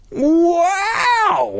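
long, high-pitched wow; crying out. A narrator's reading. Boy seeing the monster, crying out. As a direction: let out a drawn-out high whoop. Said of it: "I heard Luke cry".

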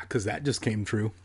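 plain reading voice

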